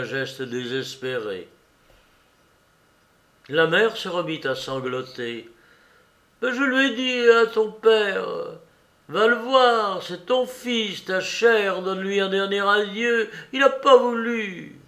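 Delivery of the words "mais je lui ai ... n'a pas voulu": put-on high, pleading voice of an old mother; an old mother begging her son to explain; old mother's plea. A narrator's reading. Old mother pleading with her condemned son, high and anguished.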